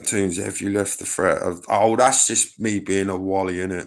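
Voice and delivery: normal talking voice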